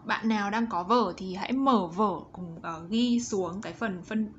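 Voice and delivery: ordinary talk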